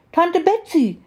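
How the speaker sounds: put-on very high little-girl cry of recognition; child calling out. A narrator's reading. Little girl spots her aunt and calls out to her. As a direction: call out high, falling at the end. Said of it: "rief sie".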